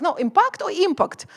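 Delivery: impact said two ways, first stressed, second stressed; stress contrast. Retold or imitated. Teacher contrasting impact stresses. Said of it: "and just like".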